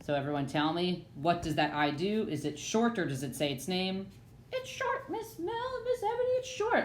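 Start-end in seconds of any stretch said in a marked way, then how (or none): 4.5-6.8 put-on very high, squeaky child voice; pupils calling out answer. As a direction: pretend to be kids piping up. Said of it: "I can hear you guys".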